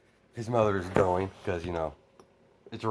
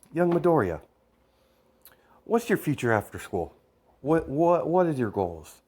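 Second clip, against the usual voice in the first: lightly voiced father, louder on the opening; a hint of a father formally quizzing the boy; questioning father; 6 semitones higher, 4 dB louder than usual. Father asks the boy his future plans, lightly imposing.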